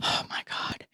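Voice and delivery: whispered exasperation; breathy oh my God. Real, not acted. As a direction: breathe it out in disbelief. Reacting to wished-for charting tech.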